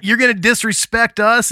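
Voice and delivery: retold angry shout. Confronting the punk who pissed on the trailer, angry. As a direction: bark it at the kid.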